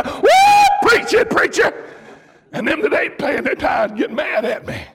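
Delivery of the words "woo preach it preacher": high, loud joyful church holler; congregation hollering. Retold or imitated. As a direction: cheer the preacher on from the pews. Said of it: "holler and say".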